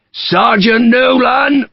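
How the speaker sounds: high, loud, slow drawn-out call. A narrator's reading. Sharp calls for Sergeant Nolan, loud and drawn out. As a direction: call across the garden. Calling out across garden.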